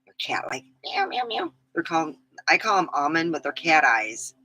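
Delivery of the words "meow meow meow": high cat meows; playful meowing. Pretend. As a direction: purr out cute meows. Cat eyes nails playful.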